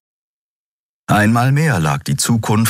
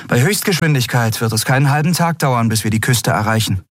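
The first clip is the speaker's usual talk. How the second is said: a bit higher, brisk and confident; lightly voiced young captain; 6 semitones higher, faster than usual. A narrator's reading. Captain reassures her crew about the voyage, brisk. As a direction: sound sure the ship is fast.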